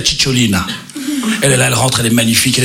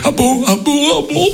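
much higher, breathless ah bon; squeaky flustered priest; 14 semitones higher than usual. Acted. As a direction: stammer it out, dazzled.

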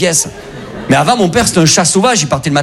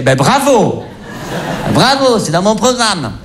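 slow, higher sarcastic bravo, a laugh in it; mock bravo; 4 semitones higher, slower than usual. To his girlfriend after her mistake, slow sarcastic mock bravo. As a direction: drawl out the fake congratulations. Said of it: "je fais".

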